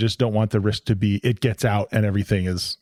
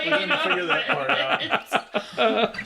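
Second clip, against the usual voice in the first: laughing; 3 dB quieter, much slower than usual. Real, not acted. Laughing at unplanned plot hole.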